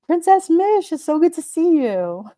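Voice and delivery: high, delighted hello; light up as a friend arrives; excited greeting. Real, not acted. Greeting a viewer arriving in the chat, high delighted hello.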